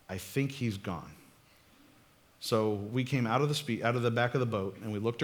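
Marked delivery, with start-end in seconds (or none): 0.1-1.1 slow, much softer and lower, barely above a breath; hushed. Retold or imitated. Kid hiding in a boat tells his friend the coast is clear, slow hushed voice. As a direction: murmur it like a scared kid still hiding. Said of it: "Eric was like".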